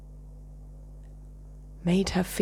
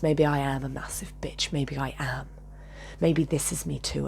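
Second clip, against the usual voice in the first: ordinary delivery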